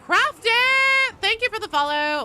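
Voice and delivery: very high, loud cheer; excited shout. Real, not acted. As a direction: yell it out with delight. Streamer cheering a follow.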